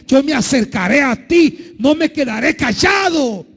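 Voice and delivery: fervent sermon plea that climbs into a shout; building preacher's cry. Acted. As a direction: preach it, rising toward a shout.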